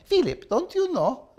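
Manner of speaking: high-pitched imitation with a heavy Russian accent; thick Russian accent. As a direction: mimic an old Russian teacher's thick accent. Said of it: "With her thick Russian accent".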